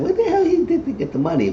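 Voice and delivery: very high put-on disbelief; incredulous squeak. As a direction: squeak out what the hell. Narrator's own retold disbelief.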